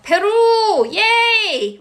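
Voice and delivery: high, drawn-out cheer; excited shout-out. Real, not acted. Host greeting a viewer from Peru, excited cheer. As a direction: cheer it with rising delight.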